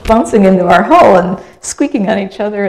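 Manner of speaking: ordinary talk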